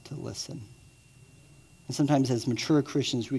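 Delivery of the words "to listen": hushed. Shown as a demonstration. Preacher urging listeners to pause, hushed and soft. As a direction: drop to a near whisper on listen. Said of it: "make a deliberate choice to choose to pause".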